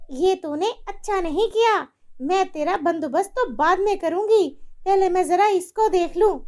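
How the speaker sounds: high, menacing threat; wicked mother-in-law. Acted. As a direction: threaten the maid coldly.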